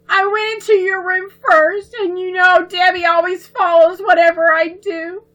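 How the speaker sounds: put-on high, pleading little girl voice, on the edge of tears; tearful pleading. A narrator's reading. Little sister begging her brother's forgiveness, tearful and high. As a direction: beg forgiveness with a choked-up childish voice. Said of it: "Babs choked out a sob".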